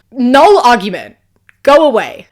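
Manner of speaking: punchy, loud and dismissive; loud dismissal. Real, not acted. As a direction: shut the argument down loudly. Dismissing the husband's argument.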